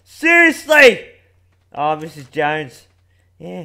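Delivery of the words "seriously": slow, loud, high yelled disbelief; furious shout. Real, not acted. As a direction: drag it out in a rage. Angry at teammate.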